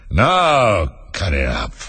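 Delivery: very slow, drowsy order; lightly voiced, lumbering lion; commanding lion. A narrator's reading. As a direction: drag out the command like a lazy lion.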